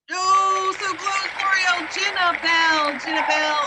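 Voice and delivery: real excitement, loud and high; thrilled host shouting out the prizewinner; excited winner announcement. Real, not acted. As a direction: call out the winner's name with a loud, high burst. Announcing the giveaway winner live.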